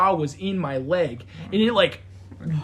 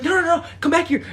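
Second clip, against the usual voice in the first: much higher flustered pleading; flustered owner; 10 semitones higher, faster than usual. Retold or imitated. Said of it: "she's like".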